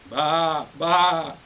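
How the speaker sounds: loud storybook baa; sheep bleat. A narrator's reading. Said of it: "bleated the sheep".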